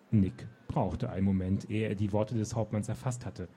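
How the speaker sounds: put-on lively read-aloud narration for young readers; story narration. A narrator's reading. Author reading her children's book to listeners. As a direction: tell the story with animated expression.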